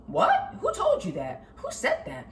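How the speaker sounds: sharp high "What?" then indignant questions; shocked someone believes that; incredulous. Retold or imitated. Reacting to friend's despair.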